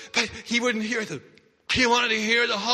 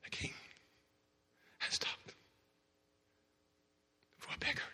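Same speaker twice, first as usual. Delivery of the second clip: breathy hushed telling; whispered awe; 10 dB quieter than usual. Acted. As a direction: let the silence speak. Hushed dramatic narration.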